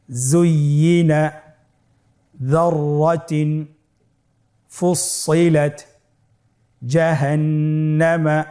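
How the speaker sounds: each Arabic word read slowly with pauses; Arabic recitation drill. Shown as a demonstration. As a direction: read each Quran word carefully for students to repeat. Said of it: "দ্রুত গতিতে একবার পড়ে যাই".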